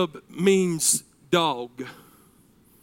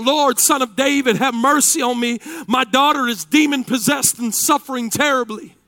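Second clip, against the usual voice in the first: mildly raised pleading cry; 6 semitones higher, 6 dB louder than usual. Acted. Canaanite woman begging Jesus for her daughter, mild cry. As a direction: read it as a restrained cry for help. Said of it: "crying out".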